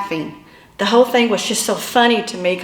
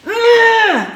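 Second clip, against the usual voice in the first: grunt; 11 semitones higher, 5 dB louder than usual. Retold or imitated. Dragged along the ground.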